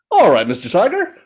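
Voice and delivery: high little frog voice; high storybook frog; agreeable frog. A narrator's reading. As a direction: pipe up gamely to the tiger.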